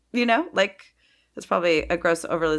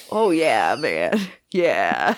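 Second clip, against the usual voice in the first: low, lazy stoner voice, much lower; [name]; 7 semitones lower, much slower than usual. Retold or imitated. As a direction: do a laid-back stoner. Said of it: "that's your [name]?".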